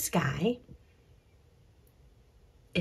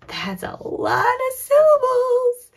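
high, loud mock astonishment; big wowed teacher exclamation; playful amazement; 6 dB louder than usual. Teacher marvels at five syllables.

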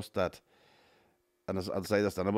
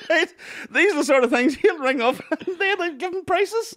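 laughing; 8 dB louder than usual. Real, not acted. Describing the friend's calls.

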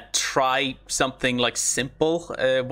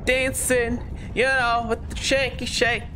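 playful sing-song; 8 semitones higher than usual. Real, not acted. Playful reaction to shaking screen.

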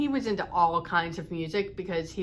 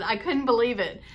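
ordinary delivery

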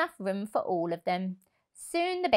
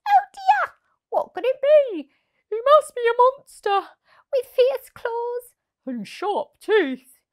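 much higher, louder squeaking panic; panicked mice; 8 dB louder than usual. Acted. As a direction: shriek about the monster. Mice panic about monster.

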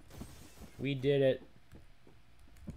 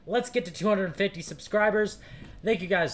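upbeat outro; 6 semitones higher, 6 dB louder than usual. Real, not acted. Energetic outro.

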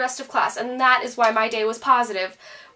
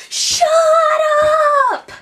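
much higher, louder mock teacher yell; retold teacher yelling shut up; 8 dB louder than usual. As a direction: bark it like a teacher silencing the class.

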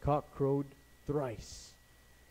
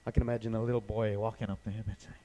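ordinary talk

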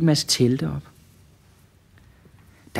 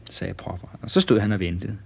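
plain reading voice

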